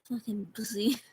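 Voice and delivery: whispered contemptuous insult; muttered scorn under the breath. Real, not acted. Insult muttered after she left.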